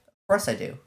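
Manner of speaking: pitched well up for the president; high, lightly voiced club president. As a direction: answer brightly as the president. Reading the club president's reply.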